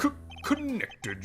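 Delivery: high stammering, caught off guard; nervous stutter. Acted. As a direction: stammer as if exposed. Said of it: "unlike you, I did not stutter".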